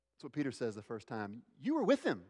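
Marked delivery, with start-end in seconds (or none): 1.6-2.2 high-pitched accusation; accusing. Acted. Accuser to Peter at the fire, high-pitched accusing. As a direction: pitch it way up to point him out.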